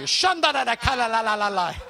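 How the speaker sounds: joking burst of babble, laughing; jokingly speaking in tongues over a brother, laughing; playful tongues. Pretend. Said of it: "We'll put some Holy Spirit on you".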